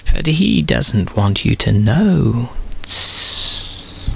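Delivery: the snake persuading Eve; hissing snake. A narrator's reading. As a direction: tempt her, hissing between phrases. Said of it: "whispered the snake".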